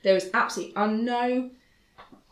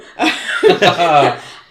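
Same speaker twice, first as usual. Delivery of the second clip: big burst of laughter; loud laugh; 13 dB louder than usual. Real, not acted. Big laugh at own story.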